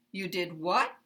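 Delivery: You did what rising on what; uptalk. Shown as a demonstration. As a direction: pitch up on what at the end. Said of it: "put it at the end like this".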